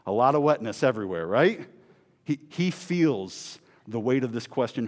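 ordinary talk

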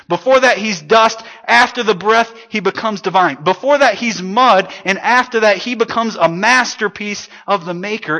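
loud, high, rising preacher's cry; preaching at full force. Acted. Pastor to congregation, preaching at full force.

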